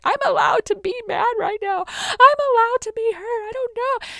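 high, loud re-enacted crying outburst; tearful upset protest. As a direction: act out insisting through tears. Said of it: "I was crying. I was so upset".

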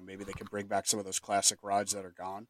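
ordinary delivery

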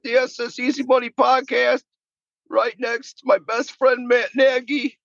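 high squeaky mock coach voice; Frank Reich impression. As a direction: pitch way up, goofy impersonation. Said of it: "Frank, we give you, like, five seconds to talk".

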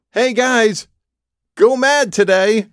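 imitating a crazy voice calling out. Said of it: "this crazy voice".

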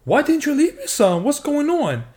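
high-pitched mock grievance; hammed-up high protest; acted complaint. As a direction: whine it as a playful accusation. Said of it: "I'ma say".

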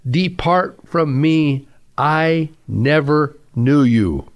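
slow, weighty, word by word; solemn pronouncement. Acted. The Lord rejecting people on Judgment Day, slow solemn pronouncement. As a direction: hand down a final verdict, spacing out each phrase. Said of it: "he's gonna say this".

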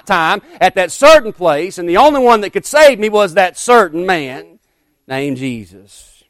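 loud, ringing revival preaching; preaching at full force. Acted. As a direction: preach it out to the whole church.

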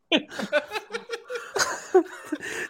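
high, helpless laughter bursting out; real laughter. Real, not acted. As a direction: laugh hard and high at the memory. Laughing at the memory.

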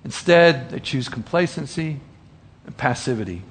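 ordinary talk

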